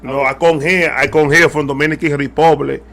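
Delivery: put-on a light Dominican-accented English, as David Ortiz. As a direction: playfully pose as the Dominican slugger in a lightly done Dominican accent. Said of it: "I can't do the accent".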